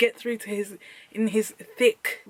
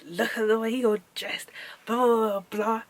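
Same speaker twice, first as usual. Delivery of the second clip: mocking his insults. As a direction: drag out the insult, then dismiss it in a whisper. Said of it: "he was saying to me".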